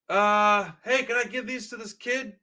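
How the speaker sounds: high, loud, lightly voiced punk asking; quoting the punk guy.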